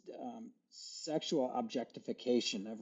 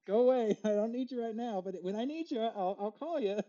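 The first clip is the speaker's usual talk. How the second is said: higher voice, laughing through a playful brush-off; laughing mock dismissal; 7 semitones higher than usual. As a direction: shoo the feeling off while giggling. Voicing a dismissal of the feeling.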